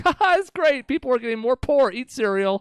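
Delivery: high, loud and grinning; gleeful mock CEO. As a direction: sound delighted about bad news. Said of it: "with this sort of weird smile".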